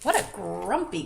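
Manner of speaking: usual speaking voice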